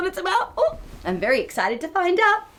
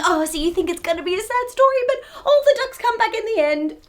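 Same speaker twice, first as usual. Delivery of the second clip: plain reading voice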